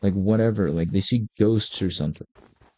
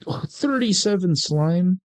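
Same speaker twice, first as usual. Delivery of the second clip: surprised; 9 semitones higher, slower than usual. Real, not acted. Surprised by huge slime haul.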